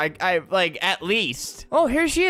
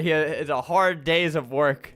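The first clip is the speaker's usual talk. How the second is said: ordinary talk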